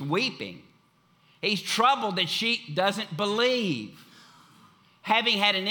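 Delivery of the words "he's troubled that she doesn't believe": ordinary talk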